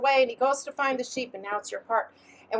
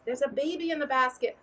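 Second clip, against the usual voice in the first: ordinary talk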